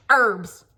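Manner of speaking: "Herbs" barked loud, no h, hard r; harsh American herbs. Retold or imitated. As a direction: hit a blunt American "Herbs". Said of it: "It's, like, so harsh".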